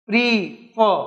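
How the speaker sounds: "pre ... for" in two separate beats; split into syllables. Shown as a demonstration. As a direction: break "pre for" with a clear pause between syllables. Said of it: "two syllables".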